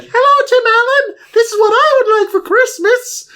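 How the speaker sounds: put-on very high, sing-song kid voice; mock children's voice. Children asking Tim Allen, very high cheerful kid voice. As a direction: chirp it like eager little kids.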